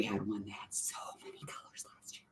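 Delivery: whispering. Real, not acted. Unvoiced whisper.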